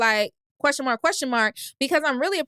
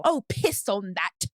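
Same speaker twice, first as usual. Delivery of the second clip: slow, lower mock London accent; 5 semitones lower, 3 dB quieter, slower than usual. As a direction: drawl it in a put-on London accent. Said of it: "my London accent low-key good".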